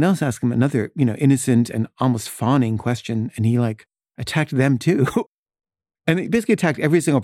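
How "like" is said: ordinary talk, nothing marked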